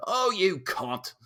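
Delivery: high, drawn-out mock British insult; hammed-up British accent; aggressive British lad. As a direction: imitate a British bloke swearing at a mate. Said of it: "was used so aggressively".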